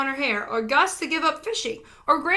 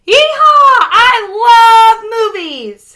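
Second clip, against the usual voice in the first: huge excited shout, higher and louder; way too loud; 10 semitones higher, 15 dB louder, slower than usual. A narrator's reading. Holler whooping at the theater, far too loud. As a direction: bellow it with pure joy. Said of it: "his words came out too loud".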